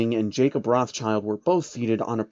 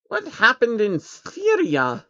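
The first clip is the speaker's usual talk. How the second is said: put-on high, slow childlike creature voice; little alien asking about the building; curious changeling; 10 semitones higher, slower than usual. Changeling asks Jacob.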